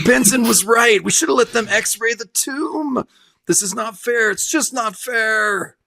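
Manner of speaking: high, whining lament, slowing at the end; hammed-up self-pity; a high, drawn-out complaint; mock whining despair. Voicing the dying guy.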